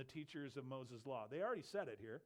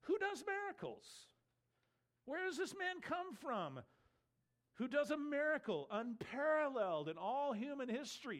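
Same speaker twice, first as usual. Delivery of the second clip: high-pitched, insistent rhetorical questions; incredulous challenge; 9 semitones higher, 4 dB louder than usual. Acted. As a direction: challenge them in high, emphatic disbelief. Acting the healed man's rebuke.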